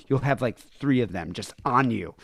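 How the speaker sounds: normal talking voice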